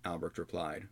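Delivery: ordinary delivery, nothing marked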